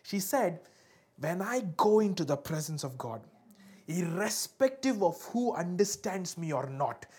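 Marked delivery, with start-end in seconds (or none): 1.2-7.0 slow, deliberate declaration; impassioned preaching. Acted. Hannah declaring her breakthrough is sure, preached slowly and emphatically. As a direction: stretch the phrases out with conviction.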